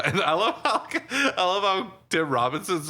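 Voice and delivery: talking through laughter; amused laughing speech. Real, not acted. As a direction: giggle through the words. Laughing while recounting scene.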